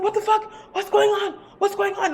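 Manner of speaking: high, bewildered panic; imitated startled alarm. Storyteller voicing her startled boy looking around, alarmed. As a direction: ask it high, as if jolted awake.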